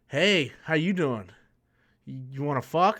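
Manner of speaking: a hint of a low, slow, soft come-on; lightly voiced hooker.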